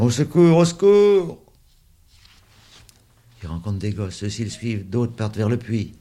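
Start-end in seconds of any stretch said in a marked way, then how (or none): 0.0-0.6 loud, high cry for help. A narrator's reading. Boy running through the park, calling for help. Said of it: "la puce court en criant". 0.6-1.4 even higher, loud desperate cry; cry for help. A narrator's reading. Boy running through the park, crying out higher. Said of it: "la puce court en criant".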